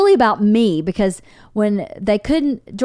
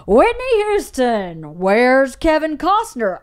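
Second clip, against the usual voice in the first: smug joking doctor; 5 semitones higher, slower than usual. Retold or imitated. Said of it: "He goes".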